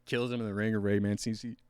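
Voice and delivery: ordinary talk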